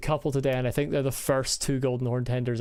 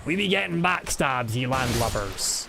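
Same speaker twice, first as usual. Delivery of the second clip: pirate voice. Acted. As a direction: growl it like a sea captain. Continues pirate voice.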